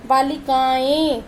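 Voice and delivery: बालिकाएं said slowly, its final एं nasalised through nose and mouth; nasal ending shown. Shown as a demonstration. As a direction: draw out बालिकाएं, the last एं ringing nasally. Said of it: "नाक से भी आएगी और मुंह से भी आएगी". (बालिकाएं धीरे बोलें, अंत का एं नाक से)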